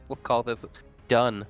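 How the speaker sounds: ordinary talk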